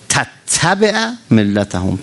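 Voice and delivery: slow intoned Arabic phrase; slow chanted Quranic phrase; Quran recitation. Acted. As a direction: stretch the Arabic words like a reciter.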